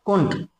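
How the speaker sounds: "कुंट" as one clipped syllable, koont; short form KUNT. Shown as a demonstration. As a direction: say "कुंट" alone, no d before nt. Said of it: "कुडंट भी बोलते हैं बहुत short में".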